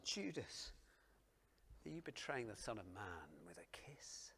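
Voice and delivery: Jesus whispers it, almost gone; hushed whisper. Acted. Preacher voicing Jesus in a whispered question. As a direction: let the question sink to a breath.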